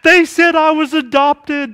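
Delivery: high-pitched mock upset child; lightly voiced wail of a distressed kid. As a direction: imitate a child all worked up, voice lifted. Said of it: "they're crying and they're all upset".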